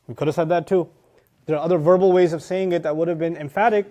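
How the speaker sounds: ordinary talk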